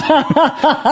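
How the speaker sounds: laughing. Real, not acted. Laughing at own joke.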